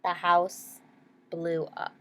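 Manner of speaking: plain reading voice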